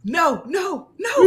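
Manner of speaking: imitating a protesting "no, no, no", rising to a laughing cry; mock protest. Host imitates Chelsea objecting in the background, mock protest. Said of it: "Chelsea in the background talking about".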